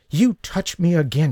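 hissing. Acted. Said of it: "she hissed".

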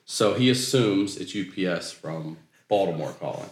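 usual speaking voice

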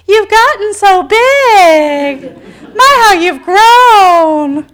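high, loud, drawn-out cooing; exaggerated holiday-relative gush, stretching every vowel. Relatives gushing at Christmas, imitated.

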